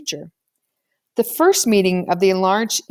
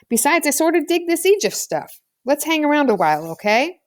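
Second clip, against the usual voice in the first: put-on eager boy; 7 semitones higher than usual. Toby voiced eagerly.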